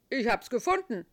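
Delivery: loud gruff terrier; barking dog voice. A narrator's reading. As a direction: bark the words like a dog. Dog character.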